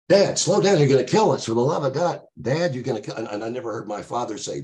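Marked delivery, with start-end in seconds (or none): 0.1-3.2 loud, high cry of alarm; imitated panic, pitched up and urgent; panicked pleading. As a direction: yell at the driver as a frightened passenger. Acting his dad panicking in the car.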